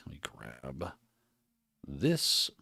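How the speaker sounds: usual speaking voice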